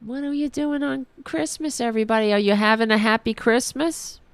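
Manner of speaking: ordinary talk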